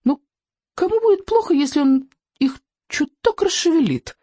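very high, wheedling creature voice; tempted little dragon. A narrator's reading. Dragon tempted to stir up deer, high wheedling voice. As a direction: squeak it as a tiny dragon. Дракончик уговаривает себя поддаться искушению.

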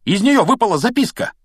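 high, loud excited announcement; calling out. A narrator's reading. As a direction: call out the discovery to a friend. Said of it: "окликнул его Рон".

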